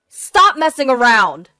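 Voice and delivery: loud, high burst of outrage; exasperated husband's shout; angry yell. Acted. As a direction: yell it to shut her down. Startled husband yells again at wife.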